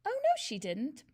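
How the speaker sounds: put-on a light, piping child's voice; piping boy. A narrator's reading. Nigel piping up to defend Miss Honey. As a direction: chirp it up like an eager little boy. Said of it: "piped Nigel".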